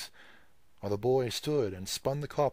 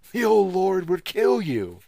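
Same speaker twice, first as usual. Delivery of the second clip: high, loud, slow feigned alarm; mock horror; 11 semitones higher, 13 dB louder than usual. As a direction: fake being shocked. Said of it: "affected a start of horror".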